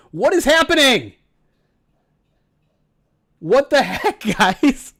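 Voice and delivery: loud, high stunned outburst; shocked. Real, not acted. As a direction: yell it in disbelief. Shocked by a big donation.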